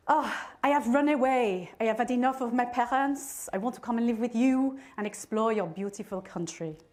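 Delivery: lightly put-on French teen accent. French pen friend announcing she ran away, light French accent.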